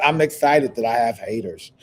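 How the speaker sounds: ordinary talk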